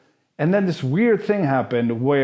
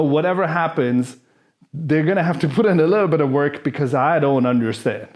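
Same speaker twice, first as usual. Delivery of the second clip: ordinary delivery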